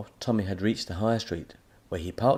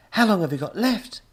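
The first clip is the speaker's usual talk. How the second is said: put-on boy voice; 12 semitones higher, 7 dB louder than usual. A narrator's reading. Tommy worried.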